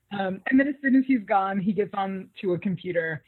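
ordinary talk, nothing marked

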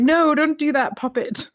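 a high, hammed-up fake British accent; mock British accent. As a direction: play Hardison putting on a bad British accent. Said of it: "trying to be British like this".

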